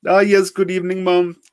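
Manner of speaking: put-on high, loud scam-caller accent; heavy Indian accent. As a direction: play a pushy call-centre scammer. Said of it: "came the heavily accented voice".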